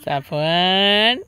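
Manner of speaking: drawn-out call. Real, not acted. Father calling son across park.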